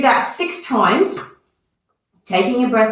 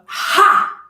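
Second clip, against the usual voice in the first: forceful breathy "ha!" pushed out high; teacher demonstrating the forced "ha!" exhale; sharp exhale; 10 semitones higher, 3 dB louder than usual. Shown as a demonstration. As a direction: shows the class how to blast the held breath out. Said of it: "we go".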